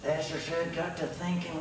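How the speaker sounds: normal talking voice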